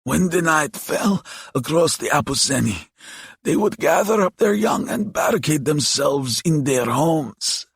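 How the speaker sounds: high-pitched croaky creature; old monster recalling its reign of fear; croaking demon. A narrator's reading. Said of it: "Diavo croaked".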